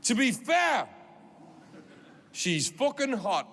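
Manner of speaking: loud, high opening shout from a heckler; imitated furious Newcastle heckle; really angry Geordie. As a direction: call out indignantly as an angry Geordie fella. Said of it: "really angry like this".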